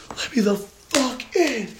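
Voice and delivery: very high, drawn-out demand; high, stretched-out retold scream; enraged mock yell. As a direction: screech it slowly at a locked door. Retold yelling at upstairs door.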